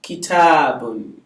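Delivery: Kitabun said slowly, ki-ta-bun with the final bun sounded; Kitabun, full ending kept; slow model word. Shown as a demonstration. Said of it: "This is a book".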